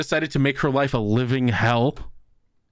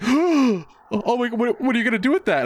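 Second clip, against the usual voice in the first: high, fast mock panic; panicked girl; 10 semitones higher than usual. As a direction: retell the girl's startled fright, hammed up.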